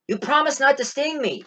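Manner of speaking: high, hurt voice of a betrayed dog; wounded dog. Retold or imitated. The dog, sinking, reproaches the scorpion in a high hurt voice. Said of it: "and the dog was like".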